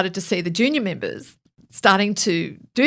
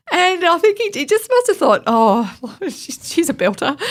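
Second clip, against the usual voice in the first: laughing; 9 semitones higher, 4 dB louder than usual. Real, not acted. Laughing as she recalls it.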